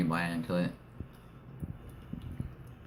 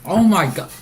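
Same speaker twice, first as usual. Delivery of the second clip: high loud burst of alarm; shocked scream; 12 semitones higher, 12 dB louder than usual. Real, not acted. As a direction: cry out in real shock. Sudden shock at candy.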